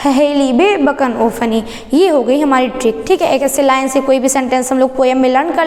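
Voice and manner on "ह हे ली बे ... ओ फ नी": syllables joined into one quick phrase; run-together mnemonic. Shown as a demonstration. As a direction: rattle the syllables off as a single line. Same mnemonic repeated run together. (अक्षर जोड़कर, एक साँस में)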